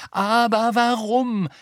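slow, high, loud demand; insistent. A narrator's reading. Hermine won't let it go, asking insistently. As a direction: stretch the question out and press it. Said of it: "beharrte Hermine".

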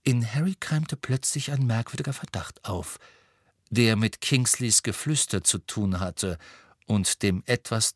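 ordinary delivery

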